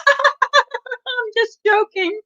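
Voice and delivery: high, drawn-out cackling voice; slow, high-pitched teasing laugh-talk; mock laughing reveal. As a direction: imitate a prankster cracking up. Friend's prank reveal, acted with laugh.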